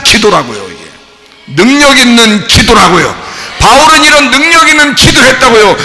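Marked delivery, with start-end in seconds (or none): 1.5-5.8 loud, high preaching shout; rousing, emphatic pulpit delivery; fervent proclamation. Real, not acted. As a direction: drive it home at full force. Preacher proclaiming powerful prayer.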